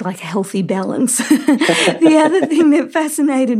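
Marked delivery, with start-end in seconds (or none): 1.2-1.9 short real laugh, a little higher; light laugh. Real, not acted. Host laughing at her own comment on balance. 2.0-3.6 words carried on a laugh, a bit louder; laughing while talking. Real, not acted. Host still laughing as she asks the next question.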